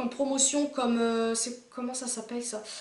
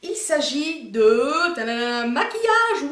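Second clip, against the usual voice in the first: playful big reveal; 7 dB louder, much slower than usual. Pretend. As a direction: announce it like a drumroll reveal. Révélation théâtrale du thème de la vidéo. (annonce théâtrale et enjouée)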